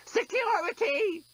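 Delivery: high, slow alarm call; robot shout. Acted. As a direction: yell for security. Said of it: "screamed the desk bot".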